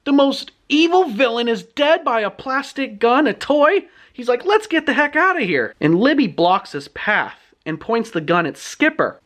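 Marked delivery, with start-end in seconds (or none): 0.0-3.9 put-on high, astonished boy voice; pitched-up, incredulous amazement; freaking out. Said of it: "Skipper's freaking out". 4.5-5.7 put-on high, urgent boy voice; pitched-up acted alarm; panicky boy. Skipper panics, wants to flee.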